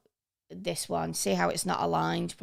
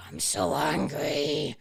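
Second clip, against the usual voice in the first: slow, drawn-out pretend anger; mock angry; 3 dB louder, slower than usual. Showing learners an emotion through a filter, mock angry. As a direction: ham up being angry, stretching the words out. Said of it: "put some emotion into something".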